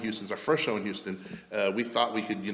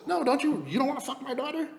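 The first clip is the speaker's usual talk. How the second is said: very high mock woman's voice; pitched far up, imitating her; imitated woman. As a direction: insist in a high put-on female voice. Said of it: "she goes".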